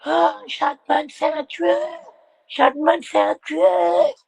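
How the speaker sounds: high, slow mock panic; mock hysterical woman. Streamer imitating the hysterical woman, high and slow. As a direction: ham up her hysteria over Chapman. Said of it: "elle était hystérique".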